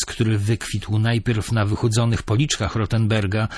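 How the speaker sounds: usual speaking voice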